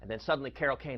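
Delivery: ordinary talk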